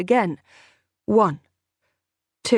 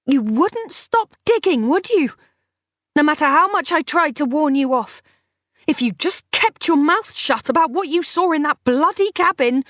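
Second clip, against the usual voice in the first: high, loud furious woman; 8 semitones higher, 4 dB louder than usual. Acted. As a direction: let the anger burst out. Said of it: "suddenly furious".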